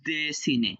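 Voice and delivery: "দেশিনে" with a long, clear de; e-vowel. Shown as a demonstration. Teacher contrasts the rule, "দেশিনে" with an e sound. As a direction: open "দেশিনে" on de, not do. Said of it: "এই ও লেটারের উচ্চারণ হবে একার".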